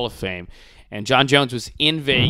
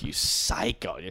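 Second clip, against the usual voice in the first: whispered insult; 3 semitones lower, 6 dB quieter, slower than usual. Real, not acted. Scolding absent fighter, aside.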